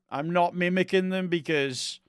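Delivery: ordinary talk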